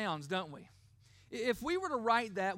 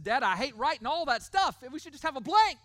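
put-on complaining kid; 3 semitones higher, 8 dB louder than usual. Kid complains to dad about writing all that stuff, loud mock protest. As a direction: imitate a child loudly protesting to a parent. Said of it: "one of my kids said".

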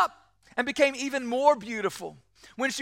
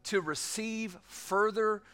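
prayer; 3 semitones lower than usual. Acted. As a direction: pray it gently and sincerely. Closing pastoral prayer.

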